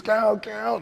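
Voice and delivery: high retold yell; high, urgent imitation of a wrestler; desperate demand. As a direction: urge the ref frantically. Heel begging ref to count.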